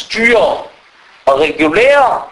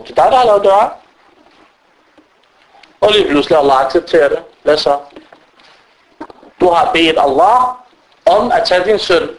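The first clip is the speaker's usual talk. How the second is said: ordinary delivery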